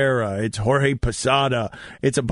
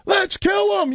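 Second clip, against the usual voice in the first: dugout yell. Retold or imitated. Marlins bench yelling to fire each other up, shouting. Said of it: "They're all yelling".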